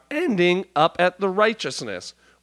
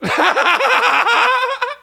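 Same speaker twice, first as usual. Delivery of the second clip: high burst of laughter; 6 dB louder than usual. Real, not acted. Cracks up at own impression.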